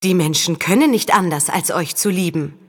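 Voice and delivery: ordinary delivery, nothing marked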